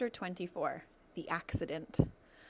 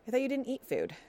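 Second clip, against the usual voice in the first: higher, louder suspicious fox; scowling; 6 semitones higher, 4 dB louder than usual. Acted. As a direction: challenge her with a frown. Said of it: "the fox scowled".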